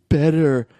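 imitated tearful babbling; mock trying to cry. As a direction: moan the word as if forcing tears. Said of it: "crying or trying to cry".

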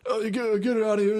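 mock angry owner. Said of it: "this guy's like".

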